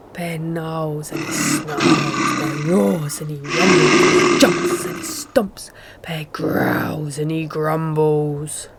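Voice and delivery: low, slow growled narration; growling bear. A narrator's reading. Bear wakes angry.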